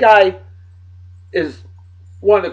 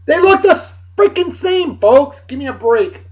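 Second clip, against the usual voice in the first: exasperated; 10 semitones higher than usual. Real, not acted. Exasperated at look-alike photos.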